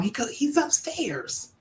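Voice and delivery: hushed answer. Retold or imitated. Answering cousins about her husband, hushed. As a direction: tell them quietly where he is. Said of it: "I'm like".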